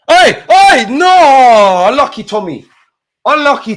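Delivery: high, loud anguished shout; fan yell. Real, not acted. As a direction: yell at the near miss. Near miss on goal.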